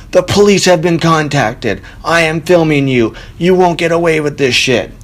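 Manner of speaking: high-pitched mock yell at an offender; imitated yelling. Man warning someone he is filming in the courtyard, imitated yelling. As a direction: imitate a neighbor calling out a warning. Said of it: "I hear yelling".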